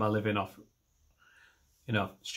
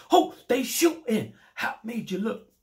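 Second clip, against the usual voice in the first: hip-hop style shout; 9 semitones higher, 4 dB louder than usual. Acted. Walking round a farming village doing [name] references, hip-hop shout. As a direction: holler it like a hammed-up rap reference. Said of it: "listen to too much hip hop".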